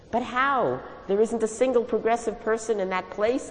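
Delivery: high, loud wail. Acted. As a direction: cry it out in despair. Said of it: "I wailed".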